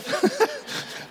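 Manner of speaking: a sudden high, breathy burst of laughter; short high giggle, caught off guard; real laugh. Real, not acted. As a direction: crack up at yourself. Laughing at his own bike crash.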